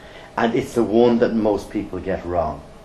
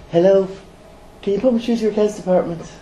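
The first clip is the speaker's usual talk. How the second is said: high fast rushed phone request; stupid question; 10 semitones higher, faster than usual. Shown as a demonstration. Caller mocking the question he never wants to hear, high and fast. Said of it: "One question I never want to hear".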